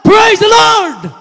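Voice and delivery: loud, high, slow shout of praise the Lord. Retold or imitated. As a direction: cry it out like a joyful worshipper. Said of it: "sisigaw ka".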